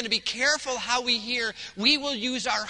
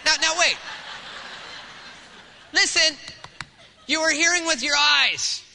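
hearing shouted, louder and higher; preaching shout; 4 semitones higher, 4 dB louder than usual. Real, not acted. Pastor shouts the point at the congregation, loud. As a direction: call it out to the whole room.

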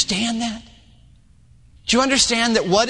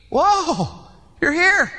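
amazed discovery; 7 semitones higher, slower than usual. Acted. Healed man meets Jesus.

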